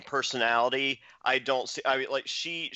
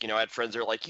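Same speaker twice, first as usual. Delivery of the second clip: ordinary talk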